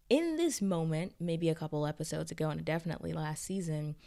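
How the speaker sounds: normal talking voice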